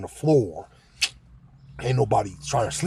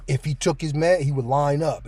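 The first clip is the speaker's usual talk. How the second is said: ordinary talk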